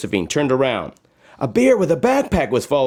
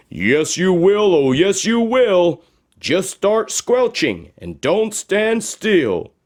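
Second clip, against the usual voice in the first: higher, sing-song voice urging her on; magic shoes encouraging; 7 semitones higher than usual. Acted. Talking shoes urging Josephine to climb, bouncy and higher. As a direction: cheer her up the hill. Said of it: "came the two voices".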